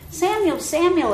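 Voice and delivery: high calling of the name, repeated. Acted. God calling the boy again, high and calling. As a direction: call out to him a second time. God calls Samuel second time.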